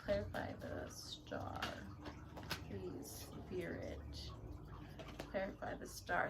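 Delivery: "Clarify the Star" whispered softly and slowly. Real, not acted. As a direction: breathe the request to the spirit. Repeated request, whispered to Spirit.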